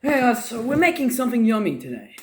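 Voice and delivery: quick bright host greeting; pep it up for viewers; upbeat vlog intro. Real, not acted.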